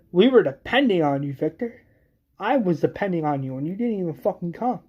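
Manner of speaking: heated acted reproach; angry reproach. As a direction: lay the blame on someone who failed you. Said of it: "she's screaming, saying".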